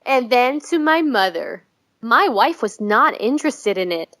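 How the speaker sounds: normal talking voice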